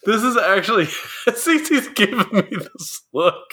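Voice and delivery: high-pitched, words breaking into laughter; giggling. Real, not acted. Host getting a look from cohost, giggling. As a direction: try to carry on through the giggles.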